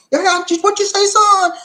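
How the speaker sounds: loud, high falsetto demand; scandalized aunt, voice way up; alarmed auntie. Acted.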